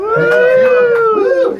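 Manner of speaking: high, loud rocker woo; hammed-up arena woo; rock crowd whoop. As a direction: whoop like a metal fan at a show. Mimicking metal crowd cheer.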